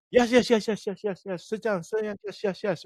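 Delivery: high, rapid-fire string of eager yeses; rattled-off high agreement, mimicking someone lost in English; imitating non-English-speaking workers. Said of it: "don't speak English. So they're like".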